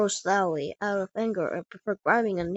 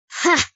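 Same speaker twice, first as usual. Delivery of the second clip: single loud, high "ha"; scoffing laugh; 8 semitones higher, 7 dB louder than usual. Acted. Giant scoffs at two challengers, loud high laugh. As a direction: burst out one mocking bark of laughter.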